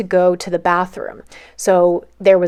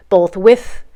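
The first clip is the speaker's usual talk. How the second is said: ordinary talk, nothing marked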